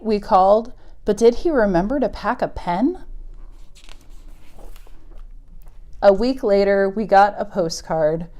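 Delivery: gentle picture-book read-aloud; story reading. Acted. Teacher reading a moose story to kids, storybook voice. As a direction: read it to the little ones, unhurried.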